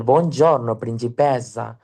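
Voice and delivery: put-on classic lilting Italian accent, drawn out; imitated Italian lilt, slow at the end; sing-song Italian. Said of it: "manejan un poco más el cantadito".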